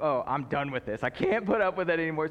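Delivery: mock fed-up outburst, building in volume; exasperated, as an imagined Paul; imitated annoyance. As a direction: act out patience finally running out. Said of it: "because he gets annoyed".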